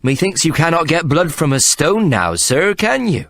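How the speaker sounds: a tipsy clerk mocking the fighter; cheeky drunk clerk. A narrator's reading.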